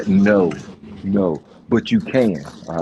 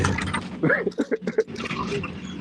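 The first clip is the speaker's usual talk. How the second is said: breathless, high-pitched giggle; high squeal laugh; 7 dB quieter than usual. Real, not acted. Laughs at own joke.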